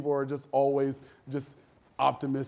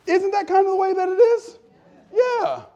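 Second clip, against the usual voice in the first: high, loud plea for agreement; insistent loud question; 8 dB louder than usual. Real, not acted. Preacher prodding the congregation to agree, loud and high. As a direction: push it out to get an answer. Asks again, louder, wanting response.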